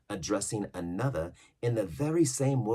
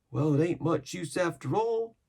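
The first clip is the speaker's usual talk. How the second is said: put-on higher young lad voice; young sailor; 12 semitones higher, 7 dB louder than usual. Young seaman answering Silver, higher lad's voice. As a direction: sound green and doubtful.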